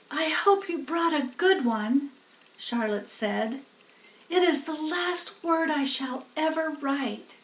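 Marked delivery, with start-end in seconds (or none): 0.1-2.1 lightly voiced gentle spider, a bit higher; Charlotte voice. A narrator's reading. 4.3-7.3 lightly voiced gentle spider, a bit higher; Charlotte voice. A narrator's reading.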